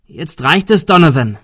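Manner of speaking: fed-up shout. Acted. Confronting Donovan in the fog, loud fed-up shout. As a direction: call him out forcefully.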